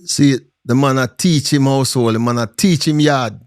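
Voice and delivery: ordinary delivery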